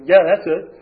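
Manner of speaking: loud, emphatic agreement; mad admission. Retold or imitated. Client owning up to feeling mad when counselors ask, loud and emphatic. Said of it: "You feel mad?".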